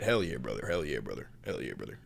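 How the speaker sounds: lightly voiced Southern good ol' boy; mock Southern catchphrase.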